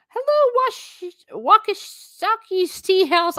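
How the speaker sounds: high, slow, drawn-out welcome; sing-song greeting. Real, not acted. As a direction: greet the viewers like a shop host. Cheery sing-song stream greeting.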